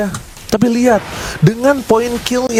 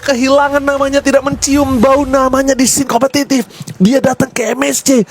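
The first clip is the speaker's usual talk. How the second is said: normal talking voice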